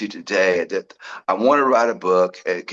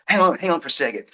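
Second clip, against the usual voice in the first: retold urgent interjection; 9 semitones higher, faster than usual. Retold sudden idea, halting the gentleman.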